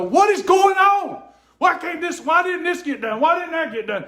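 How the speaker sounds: high-pitched rant, imitating his frustrated outburst; retold venting yell. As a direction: re-enact storming around, firing off exasperated questions. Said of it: "I'm not yelling at you".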